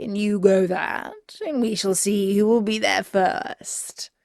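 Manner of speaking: a sly wolf, hushed at the end. Acted. Wolf plotting the race to grandmother's, sly hush.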